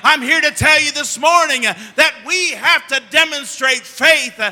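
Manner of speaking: fervent pulpit delivery; preaching. Acted. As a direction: build the sermon to a ringing climax. Pastor preaching to congregation.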